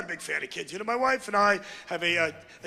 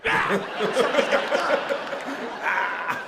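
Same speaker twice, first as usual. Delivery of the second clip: loud roar, slowed, gotta die; roaring bear; 4 dB louder, slower than usual. Acted. Comic imitating a grizzly bear roaring its threat. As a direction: bellow it like a maddened bear.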